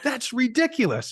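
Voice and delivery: put-on high kid voice, scandalised; a child offended by the price; indignant little boy. Son complains about airport prices in a high indignant kid voice. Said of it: "my seven or eight-year-old son said".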